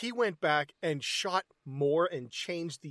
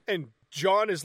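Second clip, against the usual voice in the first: ordinary talk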